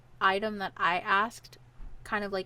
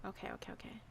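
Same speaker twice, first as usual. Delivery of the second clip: breathless whisper, much softer; whispered okays; 18 dB quieter, much faster than usual. Real, not acted. Muttering to herself after another wrong guess, whispered. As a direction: whisper it to yourself, rattled.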